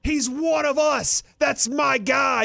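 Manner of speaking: high, slow, emphatic mock yell. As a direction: imitate a coach hollering at his fans. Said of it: "he's yelling".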